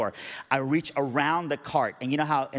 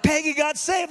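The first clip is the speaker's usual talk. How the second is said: high, loud excited cry; jubilant announcement; 8 dB louder than usual. Retold or imitated. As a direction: shout the good news to the kids. Announcing good news to celebrating kids.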